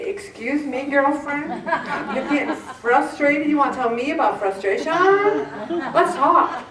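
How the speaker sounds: put-on high, sassy girlfriend challenge; mock indignant, half laughing; sassy retort. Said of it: "I wanna say".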